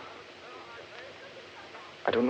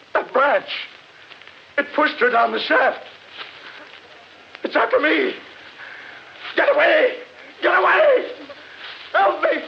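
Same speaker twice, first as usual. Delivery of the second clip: high, desperate cries for help; panicked screaming; 4 dB louder, slower than usual. Acted. Humphreys attacked by demon trees, screaming for help. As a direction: yell in terror as it closes in.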